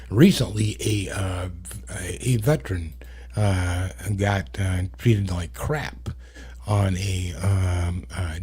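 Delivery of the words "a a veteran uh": usual speaking voice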